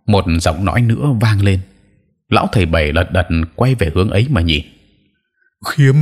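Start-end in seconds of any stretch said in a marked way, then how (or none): 5.7-6.0 startled cry. A narrator's reading. Old sorcerer crying out at a returning enemy, shocked. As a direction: blurt the name in shock. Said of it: "Lão thầy Bảy thốt lên".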